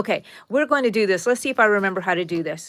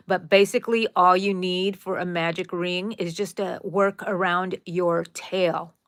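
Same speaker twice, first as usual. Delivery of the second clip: ordinary talk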